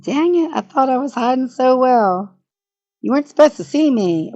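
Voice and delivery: high, loud playful gasp of being caught; playful busted whine; mock caught out. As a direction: pretend you got spotted hiding. Playful hiding, caught on stream.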